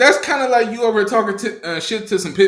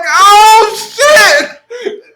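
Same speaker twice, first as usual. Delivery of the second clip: loud, high startled shriek, half laughing; high-pitched scared yelp; 14 semitones higher, 9 dB louder than usual. Retold or imitated. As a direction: reenact a panicked yelp with the voice shooting up. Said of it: "my voice went about ten decibels higher".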